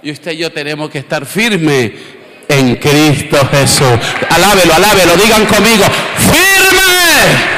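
high, slow, shouted sermon climax; preaching at full force. Acted. Pastor shouting to the church to stand firm in Christ. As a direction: drive every word home to the crowd.

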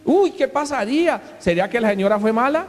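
high-pitched, put-on busybody voice; gossiping onlookers. Passers-by wondering at a tearless wake, mock scandalized gossip.

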